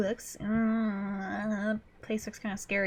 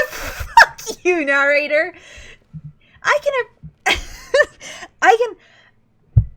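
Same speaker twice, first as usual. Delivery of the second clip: laughing; 9 semitones higher, 11 dB louder, slower than usual. Real, not acted. Laughing outburst at narrator.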